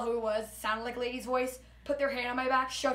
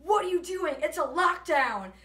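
a mildly raised, higher call, slowing on the second line; retold yell; 5 semitones higher, 5 dB louder, slower than usual. Vanessa shouting at a security guard, mild mock yell. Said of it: "and yells".

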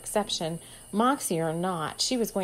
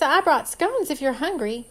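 bouncy voice; 6 semitones higher, 5 dB louder than usual. A narrator's reading. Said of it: "she said in her bouncy voice".

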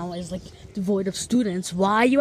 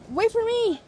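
calling out; 11 semitones higher than usual. Real, not acted. Calling after friend walking off.

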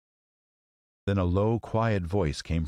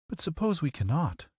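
put-on high, doubtful girl; worried little girl, higher; 10 semitones higher than usual. Dorothy asks.

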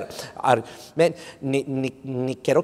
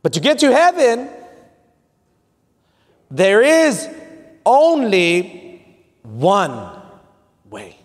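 slow, raised preacher's delivery with big pauses; emphatic preaching; 6 semitones higher, 4 dB louder, much slower than usual. Real, not acted. Preacher driving the main point home to the church, emphatic preaching. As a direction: stretch each word out for the congregation.

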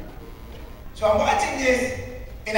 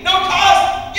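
mock disbelief; 8 semitones higher, 5 dB louder than usual. Real, not acted. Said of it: "at no cost".

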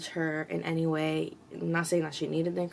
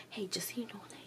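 whisper; 3 semitones higher, 13 dB quieter, faster than usual. Retold or imitated. Trainer nudges her at the gym.

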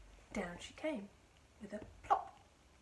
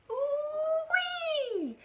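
very high, long squealing yell; 11 dB louder than usual. A narrator's reading. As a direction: shoot the voice sky-high in a wild whoop. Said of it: "Grandma yelled".

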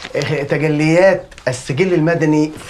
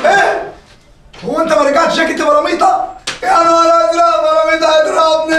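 frantic high-pitched dread; panicked; 12 semitones higher than usual. Acted. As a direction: squeal in terror of the beating. Panics over the unreturned jacket.

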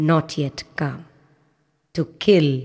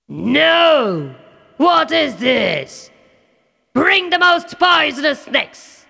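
enraged king; 9 semitones higher, slower than usual. Acted. Demon king sees his plan fail, outraged shout. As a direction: explode at the failure.

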